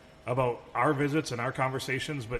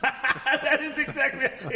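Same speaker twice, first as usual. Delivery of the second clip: words squeezed out through laughter; laughing; 14 semitones higher, 5 dB louder, much slower than usual. Real, not acted. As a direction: say it while still laughing hard. Laughing at Tinder joke.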